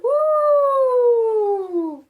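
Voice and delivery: a rising windy woo; wind whoosh. Acted. Narrator voicing the stormy wind, a howling woo. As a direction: blow it out like a gust of wind.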